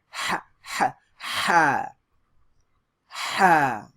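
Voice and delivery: ha with a sharp breathy h from mid-throat, said loud; Arabic ha, sharp throat h. Shown as a demonstration. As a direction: push the h from the middle of the throat. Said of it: "Very sharp".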